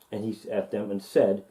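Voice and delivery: usual speaking voice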